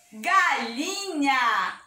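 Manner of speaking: "Galinha" drawn out by syllable; slow syllable demo. Shown as a demonstration. Teacher giving children a ga word, slowly sounded out.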